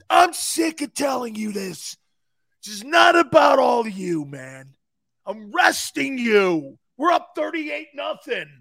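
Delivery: loud, slow, exasperated; mock coach scolding. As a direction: scold a sulking player. Coach scolding, explaining benching.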